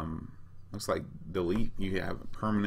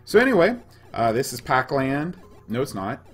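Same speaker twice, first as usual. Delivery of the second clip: upbeat host; 7 semitones higher, 10 dB louder than usual. Real, not acted. Energetic restart of the show.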